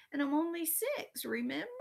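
put-on high-pitched little-kid whine; whining. A narrator's reading. Daniel reminds Elaine of his age, whining. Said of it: "Daniel whined".

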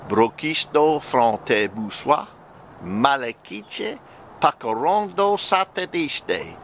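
slow, drawn-out tongues syllables; speaking in tongues. Real, not acted. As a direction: let the tongues flow slowly.

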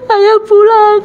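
put-on high-pitched child announcing good news; smiling child. Child announcing father's return to mama, smiling and high. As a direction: imitate a child's high, pleased call. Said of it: "anak saya tersenyum. Bilang".

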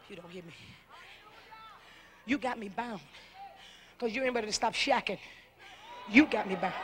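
low, intimate hushed sermon voice; hushed preaching. Acted. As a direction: bring it down to a murmur. Long hushed, intimate preaching passage.

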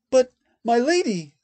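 high, loud, panicked; the kneeling man begging his lady; desperate protest. A narrator's reading. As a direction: cry out in desperation.

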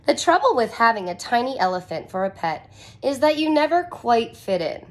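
normal talking voice

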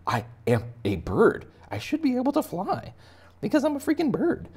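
high, loud exasperated chicken voice; indignant chicken. Pretend. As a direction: protest like a fed-up cartoon bird. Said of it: "He's like".

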